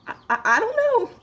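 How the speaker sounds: high-pitched, stumbling over the first word; acted-out high, baffled stammer; flustered stammer. Clerk stumped by customer's weird remark, flustered high stammer. Lost for words at odd customer.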